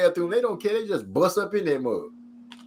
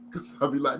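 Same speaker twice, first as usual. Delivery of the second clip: ordinary talk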